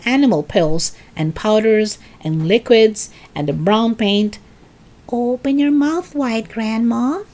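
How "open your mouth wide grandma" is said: put-on higher-pitched boy George, drawn-up sing-out; George coaxing. A narrator's reading. George to Grandma before giving medicine, coaxing. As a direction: coax her lightly in a higher voice. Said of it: "he said".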